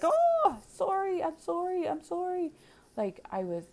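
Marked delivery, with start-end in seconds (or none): 0.0-2.5 squeaky, much higher, flustered mock apology; embarrassed apology. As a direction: gush out a high, mortified sorry. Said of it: "I was embarrassed".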